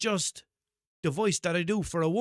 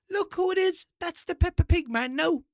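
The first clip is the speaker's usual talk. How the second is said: high, loud mock parent; hammed-up, raised-pitch pointing out; excited parent; 13 semitones higher, 6 dB louder than usual. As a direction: announce a celebrity to a small child. Parent excitedly pointing him out to child.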